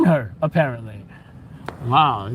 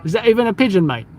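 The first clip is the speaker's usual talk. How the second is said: put-on Australian accent; 3 semitones higher, faster than usual. Puzzling over New York City pigeons, mock Australian accent. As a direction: ask it as a hammed-up Australian. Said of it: "In my best Australian voice".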